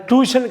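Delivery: "டியூஷன்" as "too-shun", no y-glide; wrong pronunciation. Shown as a demonstration. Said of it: "டியூஷன் கிடையாது".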